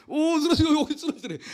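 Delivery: high, squealing oh of surprise; mock surprise. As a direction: yelp as the cactus falls.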